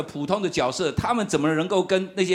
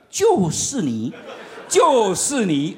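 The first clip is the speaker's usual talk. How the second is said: inner voice; much slower than usual. Retold or imitated. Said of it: "又有一种声音又出现了".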